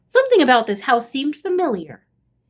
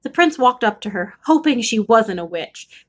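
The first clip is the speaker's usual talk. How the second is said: usual speaking voice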